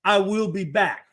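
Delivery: slow, mock French cop; French-accented Terminator, drawn out; heavy French accent. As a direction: order them in a deliberate French accent. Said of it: "with that heavy, think Fre-, French accent".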